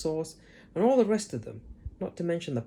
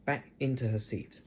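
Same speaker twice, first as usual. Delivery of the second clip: usual speaking voice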